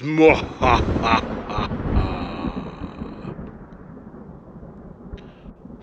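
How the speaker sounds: long ghoulish laugh; evil cackle. Acted. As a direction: cackle like a horror host. Spooky cold open laugh.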